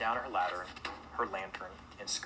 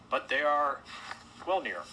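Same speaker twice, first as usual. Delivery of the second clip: higher, louder hesitant host; reluctant admission; 6 semitones higher, 6 dB louder than usual. Acted. Host admitting wolves are near, hesitant admission. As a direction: admit it awkwardly, with a pause.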